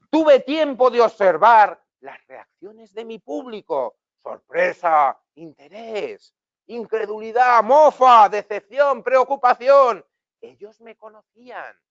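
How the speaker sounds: put-on high, loud, slow singsong for little kids; preschool storytime voice. Shown as a demonstration. Teacher reads the sentence as if to preschoolers, high and slow singsong. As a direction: read it like telling a story to a kindergarten class. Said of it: "contársela a los niños, como si estuviéramos en clase de parvulitos".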